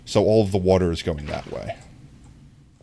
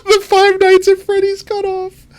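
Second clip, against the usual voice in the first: laughing thanks; 4 dB louder, slower than usual. Real, not acted. Laughing at chat, thanking gifter.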